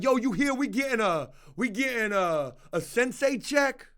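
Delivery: playful prisoner voice buzzing about a check; excited prisoner. Retold or imitated. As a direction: hype up the rumor like a prisoner. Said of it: "Prisoners probably in that bitch talking about".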